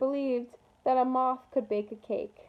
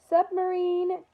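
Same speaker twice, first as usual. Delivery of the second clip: yelled command; 5 semitones higher, much slower than usual. A narrator's reading. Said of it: "yelled Carlos".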